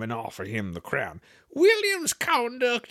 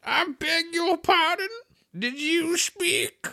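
stiff polite challenge; slower than usual. Acted. Mouse frowning but politely.

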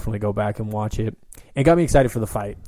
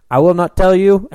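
quoting George; 9 semitones higher, 10 dB louder than usual. Retold or imitated. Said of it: "he's like".

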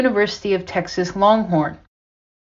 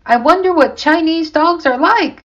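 higher character voice; 7 semitones higher, 4 dB louder than usual. Acted. Character quote in story.

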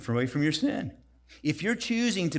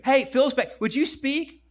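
higher, louder proud gushing; proud mom calling out; 10 semitones higher, 5 dB louder than usual. Retold or imitated. Mom proudly shows off her son to the guests, loud and excited. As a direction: announce him to the room like a proud mom. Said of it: "When I went through seminary".

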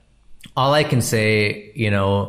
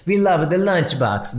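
imitating a European festivalgoer, pitched higher; a playful put-on European accent; a filmmaker retelling a German tourist's praise in a mock European accent; vague European accent; 7 semitones higher than usual. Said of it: "I don't know what accent I'm doing".

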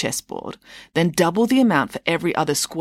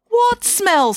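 much higher and louder, sharp; alarmed; 5 dB louder than usual. Real, not acted. Daughter startled at Mum's joke, high and alarmed. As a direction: jolt up, suddenly worried. Vanessa alarmed at being smelly.

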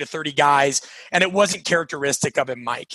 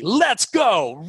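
mock pep-talk cheer, pitched up; rah-rah cheer; 4 semitones higher than usual. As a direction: toss out a rah-rah rallying cry. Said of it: "rah-rah version of themselves".

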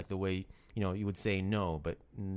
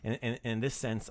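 ordinary delivery

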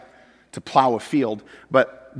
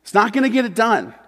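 louder, higher preaching push; preaching at full force; 7 semitones higher, 6 dB louder than usual. Acted. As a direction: drive it home to the congregation.

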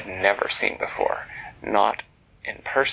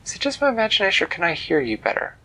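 put-on high, fast eager girl; excited; 9 semitones higher, 4 dB louder, much faster than usual. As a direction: rush it out in delight. Excited girl asks butterfly.

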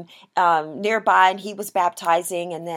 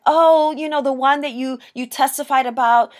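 higher, louder mock fluster; in a tizzy; 5 semitones higher, 5 dB louder than usual. John's followers come up to him complaining, mock tizzy. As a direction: imitate worked-up followers tattling. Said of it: "in a little bit of a tizzy".

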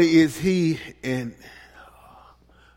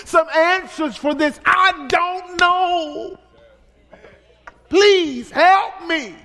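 acted prayer; 9 semitones higher, 5 dB louder than usual. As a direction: plead with God, drawing out the final words. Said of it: "begin to say".

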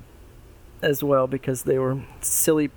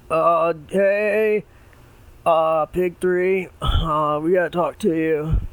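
frat bro; 3 semitones higher, 4 dB louder, slower than usual. Acted. Pig one at the door.

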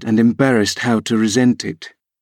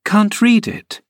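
put-on much higher girlish cry on read it; incredulous young Catherine; surprised cry; 11 semitones higher, slower than usual. A narrator's reading. Catherine reacting to Hareton, surprised high cry. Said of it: "cried Catherine".

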